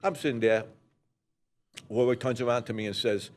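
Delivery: normal talking voice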